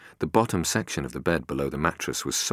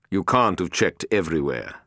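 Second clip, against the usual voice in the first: louder, clipped rebuke; cold boss Harper; 3 semitones higher, 5 dB louder than usual. A narrator's reading. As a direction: press the word can't hard. Narrator voicing Harper.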